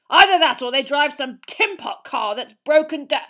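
loud, high, agitated rant; irate blustering uncle. Acted. Uncle grumbling about the visitors being late, irate bluster.

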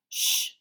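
sharp voiceless shush; hushing "shh". Acted. Someone inside chimney silencing the others, hushing. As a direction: hush the others quickly.

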